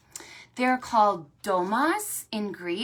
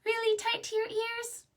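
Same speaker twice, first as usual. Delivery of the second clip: high, squeaky puppet voice; very high puppet voice for Fred; 13 semitones higher than usual. Acted. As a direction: give Fred a small high voice. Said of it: "Fred, can you do that?".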